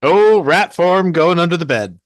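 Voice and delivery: sudden loud panic, played for the game; urgent exclamation. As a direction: blurt out the emergency move. Urgent reaction to door handle.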